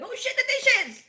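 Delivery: high, loud yelp of alarm; panicked high-pitched exclamation; sudden panicked realization. Acted. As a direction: blurt it out high, as if just remembering. Acts out waking in panic.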